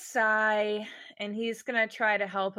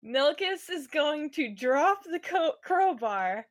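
normal talking voice

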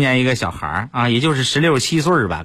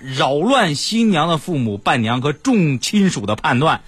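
ordinary talk

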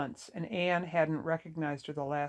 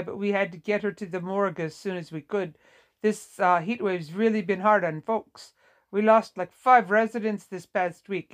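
louder, lively character voice, regretful; sorrowful Vietnamese nurse; 3 semitones higher, 6 dB louder than usual. A narrator's reading. Nurse telling a daughter of her mother's last night, sorrowful. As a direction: talkative nurse explaining herself with a touch of sorrow. Nurse figure explaining, apologetic.

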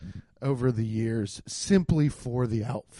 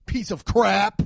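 pitched-up clean-mouthed outburst; mock indignation; 6 semitones higher, 4 dB louder, slower than usual. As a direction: play a prim boss cursing mildly.

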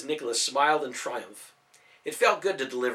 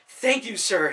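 much higher, louder delighted exclaim; excited thanks; 7 semitones higher, 5 dB louder, slower than usual. Acted. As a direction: burst with thanks. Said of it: "Simon exclaimed".

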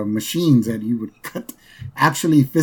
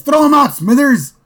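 put-on much higher, louder commanding old man; boss ordering; 13 semitones higher, 6 dB louder than usual. Boss ordering his assistant, high loud command. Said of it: "He says".

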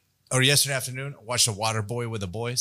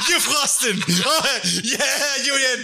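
high giggly teasing; laughing teasing; 9 semitones higher, slower than usual. Real, not acted. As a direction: tease him through laughter.

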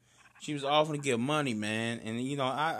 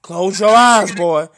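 loud; 15 dB louder, slower than usual. Acted. Acts out a preacher scene.

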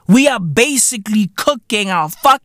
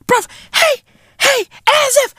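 excited. Real, not acted. Incredulous outburst.

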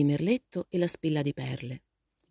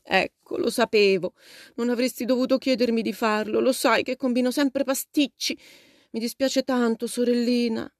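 upset, higher-pitched self-reproach; tearful grumble; 7 semitones higher, 4 dB louder than usual. A narrator's reading. Poor Jo apologising to her sister, tearful grumbling. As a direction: grumble miserably, pitched up and close to tears. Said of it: "borbottò la povera Jo".

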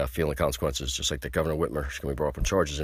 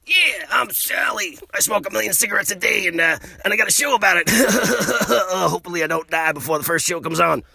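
much higher, loud cartoonish woman's voice with a laugh in it; Sally promo voice; 12 semitones higher, 5 dB louder than usual. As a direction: ham up a chain-smoking woman's TV promo, high and loud. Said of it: "that was a promo for the Sally Show".

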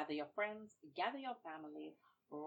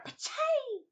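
sharp, loud, high slap cry; slap sound; 10 dB louder than usual. Acted. Storyteller voicing Babuki's slap, sharp and loud. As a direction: shout the slap noise.

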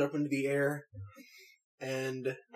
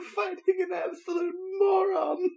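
laughing shout. Real, not acted. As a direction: squeal it through laughter. Laughing while describing the giants.

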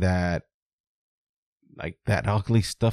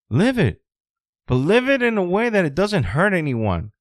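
fired-up rant; 5 semitones higher than usual. Real, not acted. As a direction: yell it like a coach pushing you to fight. Fired-up pep talk rant.

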